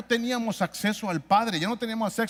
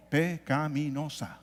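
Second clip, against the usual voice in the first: PECAMINOSA slowed, each syllable set apart; 2 semitones lower, slower than usual. Real, not acted. Preacher answers own question to congregation, "pecaminosa" syllable by syllable. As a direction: spell out "pecaminosa" slowly, beat by beat.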